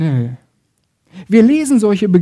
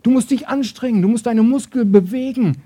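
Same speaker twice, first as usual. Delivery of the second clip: high, pushing coach-like urging; driving exhortation; 6 semitones higher than usual. Acted. The Law drives us to try ever harder, urgent exhortation. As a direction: keep pressing them to work harder. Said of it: "Das Gesetz sagt".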